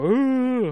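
high drawn-out "ooh"; goofy "ooh". Retold or imitated. Joker showing off to his wife, goofy high "ooh". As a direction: hoot a long, high "ooh". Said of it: "whip my dick out and go".

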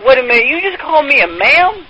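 normal talking voice

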